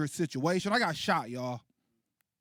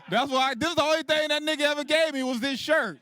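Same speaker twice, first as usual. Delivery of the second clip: high, raised-voice protest; indignant outburst; 8 semitones higher, 4 dB louder than usual. Acted. Son explaining his only gift from dad, indignant outburst. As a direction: burst out defensively, pitched high.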